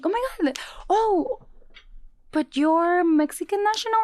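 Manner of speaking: high, surprised recruiter voice; startled then doubtful; surprised question. Retold or imitated. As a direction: sound caught off guard.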